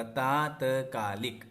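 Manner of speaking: तात्कालिक drawn out with long first ता; teacher repeats तात्कालिक slowly; slow correct word. Shown as a demonstration. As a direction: stretch the ता in तात्कालिक. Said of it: "ता में आ की मात्रा".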